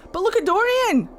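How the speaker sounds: high, loud cry of excitement; excited outburst. Real, not acted. Streamer cheering on a favourite companion in the game, excited. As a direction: burst out high and loud in admiration.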